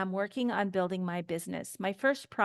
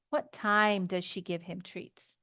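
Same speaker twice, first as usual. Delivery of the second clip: ordinary delivery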